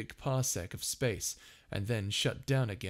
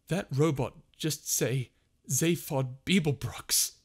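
slow, louder disbelieving question; stunned; 5 semitones higher, 6 dB louder than usual. A narrator's reading. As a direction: Ford can't believe it. Ford stunned.

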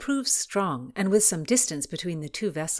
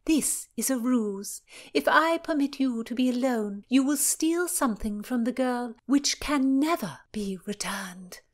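haughty princess; 7 semitones higher, 3 dB louder than usual. Acted. Cecilia accuses.

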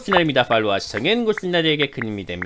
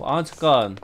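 ordinary delivery

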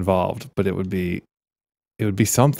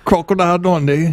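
high, hammy mock Australian accent; exaggerated fake Australian accent; put-on accent; 8 semitones higher than usual. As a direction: crow it in a silly Aussie accent. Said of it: "talk in an English accent".

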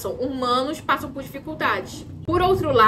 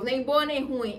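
normal talking voice

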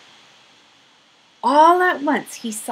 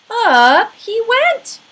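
higher, louder, drawn out slowly; rising excitement; 13 semitones higher, 11 dB louder, slower than usual. A narrator's reading. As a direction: make the ride up feel thrilling. Escalator lifts bear upward.

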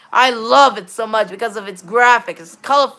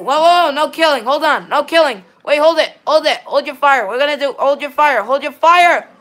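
urgent raised calls to stop players; calling out; 4 semitones higher, 7 dB louder than usual. Real, not acted. Gamer calling teammates to stop shooting, loud and urgent. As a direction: shout them down, hold your fire.

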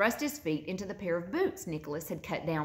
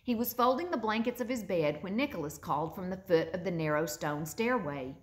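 ordinary delivery

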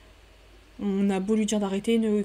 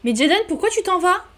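voix de Sim vexée; 5 semitones higher, 5 dB louder than usual. Acted. Fait parler la Sim à Jaden.

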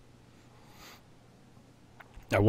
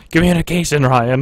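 mock scolding; 8 semitones higher, 7 dB louder than usual. Real, not acted. Teasing teammate for poor callouts.

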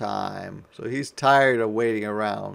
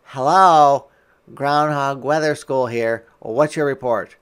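loud phone greeting; 9 dB louder than usual. Acted. Answering phone calls.

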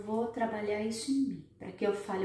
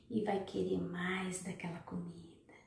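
hushed whisper; 3 semitones lower, 7 dB quieter than usual. Real, not acted. Speaker to viewers, a hushed whispered aside. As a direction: breathe it like a secret.

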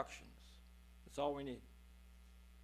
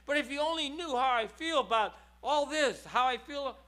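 a hint of a pleading Jesus, higher and louder; Jesus crying out; 9 semitones higher, 8 dB louder than usual. Jesus crying out to Doug, mildly voiced and pleading. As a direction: plead as the Lord who is hurting. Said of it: "as he cries out".

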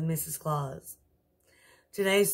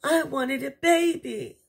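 crying queen; 9 semitones higher, 4 dB louder than usual. Acted. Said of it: "cried the queen".

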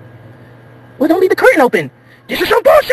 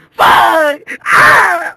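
high shouted screams of panic; panicked cry; 9 semitones higher, 5 dB louder than usual. Acted. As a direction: scream as the plane drops. Crash panic.

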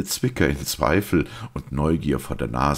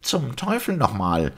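high-pitched, exasperated doctor; exasperated cry; 10 semitones higher than usual. A narrator's reading. Doctor losing patience with the priest, exasperated cry. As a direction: curse in a high, impatient voice. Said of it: "rief Simon".

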